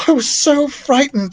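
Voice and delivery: put-on high, relieved girl voice; frightened girl. Beatrice hugs Daniel after her scare, high relieved girl voice. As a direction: let the fear spill out.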